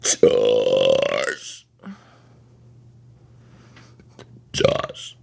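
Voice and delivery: burped name; burping the words. Shown as a demonstration. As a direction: burp out the word Josh. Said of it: "Could you burp the name Josh?".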